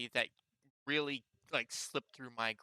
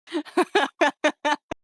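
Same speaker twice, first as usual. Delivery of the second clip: loud laugh; 16 dB louder than usual. Real, not acted.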